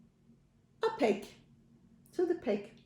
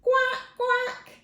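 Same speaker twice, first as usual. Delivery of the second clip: high, louder quacking duck; 13 semitones higher, 5 dB louder than usual. Acted. Duck's line in a farm story, quacking. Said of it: "said the duck".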